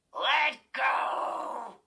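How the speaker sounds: loud growled command; the dummy growling at Chris to let go. A narrator's reading. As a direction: growl it, thrashing. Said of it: "he growled".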